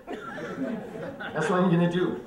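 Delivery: ordinary talk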